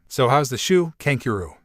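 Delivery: ordinary delivery, nothing marked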